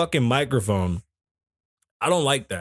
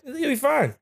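high-pitched disbelieving repeat; incredulous echo; 13 semitones higher than usual. Retold or imitated. Patient echoing doctor's brush-off, incredulous. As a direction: throw the doctor's words back, way up high.

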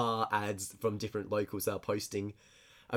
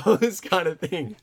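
normal talking voice

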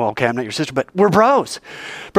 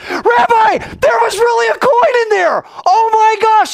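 much higher and louder, thrilled shout; amazed Peter; 6 dB louder than usual. Retold or imitated. Peter shouting to the rabbi about the coin, amazed. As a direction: Peter bursting with disbelieving joy.

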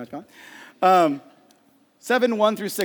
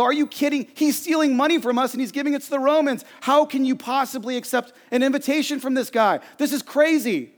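higher, outraged mock protest; Pharisees flipping out; 7 semitones higher than usual. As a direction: play the indignant Pharisees, pitched up. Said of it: "the Pharisees flip out".

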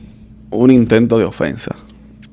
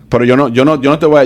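usual speaking voice

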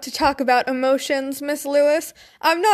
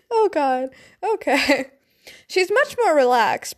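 usual speaking voice

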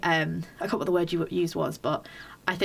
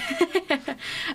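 quick high burst of laughter; short high laugh; 11 semitones higher than usual. Real, not acted. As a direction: laugh at your own joke. Laughs at her own remark.

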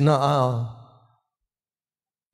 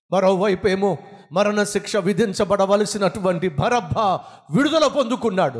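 normal talking voice

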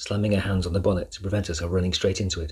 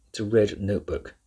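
plain reading voice

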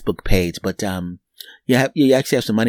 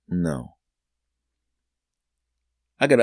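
usual speaking voice

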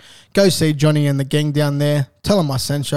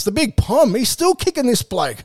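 raised, playful ribbing voice; teasing mate; 8 semitones higher than usual. Pretend. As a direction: poke fun at the old pom, higher. Ribbing an older player.

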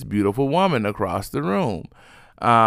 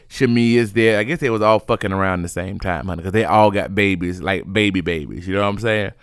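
normal talking voice

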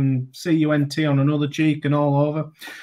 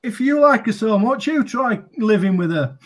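a hint of a higher retort; comeback; 7 semitones higher than usual. Harmison retorting to the heckler, lightly voiced comeback. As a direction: fire it back at the heckler. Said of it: "Steve Harmison turned round and went".

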